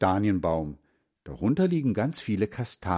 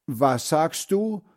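put-on a slow, slightly higher old man asking; lightly voiced grandfather; 6 semitones higher, slower than usual. Deaf grandfather asking the boy to repeat. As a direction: lightly play a hard-of-hearing grandpa.

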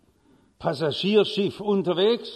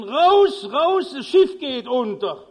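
much higher, much louder roar; bellowing at full force; 4 semitones higher, 7 dB louder, slower than usual. Retold or imitated. Steward roaring at the card players in the salon, full bellow. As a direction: yell with all your strength to wake them. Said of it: "Brüllt aus Leibeskräften".

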